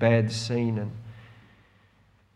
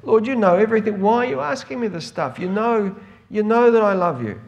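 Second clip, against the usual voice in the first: much higher, louder exasperated protest; acted-out exasperation, pitched up and insistent; 9 semitones higher, 6 dB louder than usual. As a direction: plead back, fed up with being asked. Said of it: "he gets kind of exasperated by the third time".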